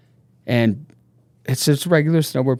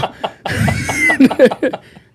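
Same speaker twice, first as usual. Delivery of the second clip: loud high shrieking laugh; high cackling laugh; big laugh; 7 dB louder than usual. Real, not acted. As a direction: burst out laughing. Laughing at the drunk story.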